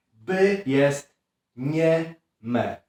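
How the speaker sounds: ordinary talk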